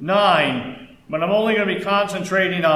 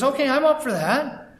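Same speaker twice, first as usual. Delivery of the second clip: high, light pig voice in a joke; cheerful pig; 8 semitones higher than usual. Retold or imitated. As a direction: pop up high as a willing pig.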